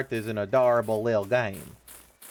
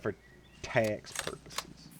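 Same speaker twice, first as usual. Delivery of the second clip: whispered aside; 4 semitones lower, 12 dB quieter than usual. Acted. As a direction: drop it as a secret joke. Deadpan whispered punchline.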